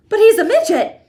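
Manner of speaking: very high, loud outburst; father shouting in dismay; shocked shout. A narrator's reading. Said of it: "shouted Mister T V".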